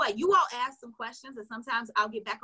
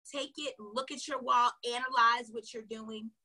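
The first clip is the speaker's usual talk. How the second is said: ordinary delivery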